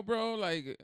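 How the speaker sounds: usual speaking voice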